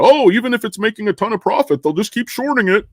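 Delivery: high, quick mock-naive protest; mocking doubters. Mimicking people who think the market ignores profit, mocking.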